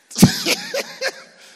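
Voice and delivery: loud high-pitched laugh. Real, not acted. Preacher laughing with congregation, loud high laugh. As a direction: burst out in a high, loud cackle.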